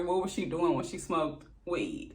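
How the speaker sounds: usual speaking voice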